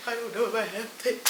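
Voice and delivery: very soft, fast self-talk; muttered under the breath; muttering to herself. Real, not acted. Muttering then repeating it aloud.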